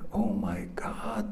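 hushed, whispered amazement; awed whisper. Retold or imitated. Gehazi sees the angels around the mountain, awestruck whisper. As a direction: whisper it as if stunned by the sight.

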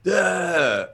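high, loose "ah" like someone unhinged; insane drooling groan. Retold or imitated. Man imitating himself raving alone at the ranch, deranged drooling cry. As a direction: moan like a drooling madman. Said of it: "like an insane person drooling".

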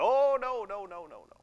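high mock-panicked protest, tumbling down and fading; alarmed denial. Brothers answering a spying accusation, in mock alarm. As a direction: start high and loud, then drop away quickly. Brothers deny being spies.

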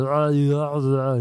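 put-on dopey drunken babble; loud drunk friend. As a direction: imitate a dopey drunk, slurring the noises. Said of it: "He was kinda like a drunk me".

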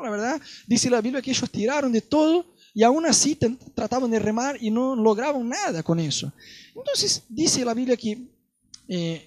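ordinary talk

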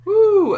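high loud whoop; excited woo. Real, not acted. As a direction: let out a big cheering woo. Cheering mid-list.

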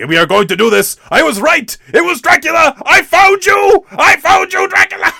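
hammed-up, high-pitched triumphant shouting; maniacal yelling. Crazed vampire hunter finding Dracula, hammed-up yelling. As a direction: build to a mock-crazed, high yell. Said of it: "he's, like, yelling about".

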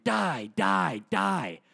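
mock yell, each word pitched high; imitated yelling. Grandson mimics his grandma's recorded rant, mock yelling. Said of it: "a clip of her yelling".

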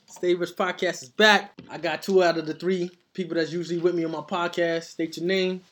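usual speaking voice

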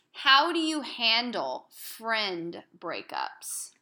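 dramatic, slow question; slow dramatic read. Acted. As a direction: draw it out slowly.

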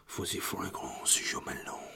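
slow, whispered French perfume-ad voice; French accent. Shown as a demonstration. Podcaster proving he can do foreign voices, French accent. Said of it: "I should do the French accent".

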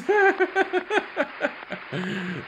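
long high-pitched run of giggles. Real, not acted. Viewer spotting Jason Chaps on screen, high giggling. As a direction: break into high, rapid giggling.

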